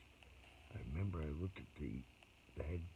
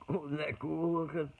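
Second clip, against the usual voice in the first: high, loud mock amazement; imitated carried-away wonder; 10 semitones higher, 8 dB louder than usual. Dad distracted from the hamster ball, imitated loud wonder. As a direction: gush loudly like someone carried away. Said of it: "I'd get kinda like".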